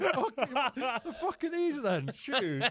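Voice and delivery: chuckling in mock confusion; laughing disbelief. Retelling his reply to his mate, laughing and baffled.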